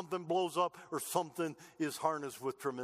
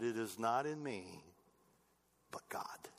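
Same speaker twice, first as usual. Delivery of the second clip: humble quiet quote; 6 semitones lower, 5 dB quieter than usual. Retold or imitated. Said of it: "He said".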